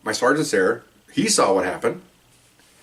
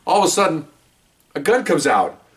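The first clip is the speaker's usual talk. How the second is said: ordinary delivery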